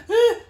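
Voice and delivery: high, loud cold-shock gasp; sudden hyperventilating yelp, acted out; sharp gasp. Ice bath newcomer on first going in, sharp gasp. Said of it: "like, hyperventilating a lot".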